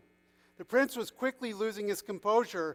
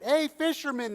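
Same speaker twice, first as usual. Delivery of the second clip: bright-pitched hail, louder; calling out; 9 semitones higher, 6 dB louder than usual. A narrator's reading. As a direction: call over to someone working. People call out to fisherman.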